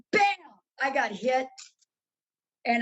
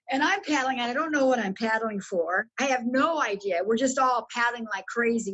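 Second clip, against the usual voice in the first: usual speaking voice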